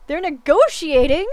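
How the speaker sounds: slow, loud, rising in shock; can't believe the news; shocked disbelief. Real, not acted. Shocked at courtroom revelation.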